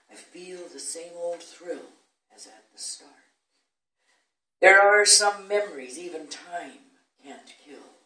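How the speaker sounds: measured sonnet reading with stretched stresses; poetry recitation. Acted. Recites own sonnet to audience.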